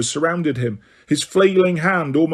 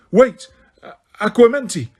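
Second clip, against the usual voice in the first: urgent shout; 6 semitones higher, 3 dB louder than usual. A narrator's reading. Harry casting spell urgently.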